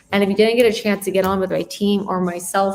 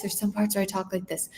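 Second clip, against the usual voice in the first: low and soft; 7 dB quieter than usual. Shown as a demonstration. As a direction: drop to a much softer voice.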